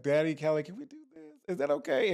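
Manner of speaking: high, pleading mock ask to the producer; sweetly begging Daddy Keller. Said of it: "Yeah, like".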